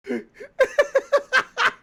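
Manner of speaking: loud, high cackle of laughter; high burst of laughter. Real, not acted. Streamer cracks up at own joke to chat, cackling laugh.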